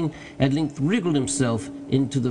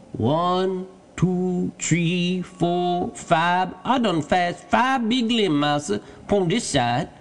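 high-pitched dialect voice, counting; Jupiter, obedient servant; 6 semitones higher, much slower than usual. A narrator's reading. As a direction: call the count down from high in a tree. Jupiter counts limbs from up tree.

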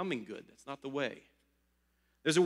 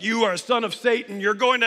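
loud, raised preacher's condemnation; imitating preacher shouting; 5 semitones higher, 6 dB louder than usual. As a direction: hammer it at him like fire and brimstone. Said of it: "shouting all of these things".

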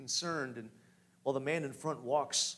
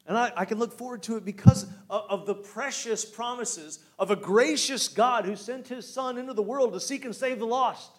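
higher and louder, full-force preaching; fervent preaching; 6 semitones higher, 6 dB louder than usual. Acted. Pastor preaching hope to the church, fervent. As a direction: lift the congregation with joy.

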